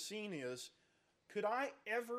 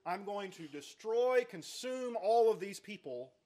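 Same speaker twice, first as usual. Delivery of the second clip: slow, higher and louder threat on destroy; God's threat; 3 semitones higher, 7 dB louder than usual. Acted. Preacher voicing God threatening to consume Israel, loud and slow. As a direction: build the threat, hit destroy.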